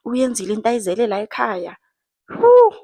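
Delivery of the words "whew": high, loud whew of disbelief; exasperated whew at the husbands. Real, not acted. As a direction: blow out a big disbelieving whew. Exasperated exclamation.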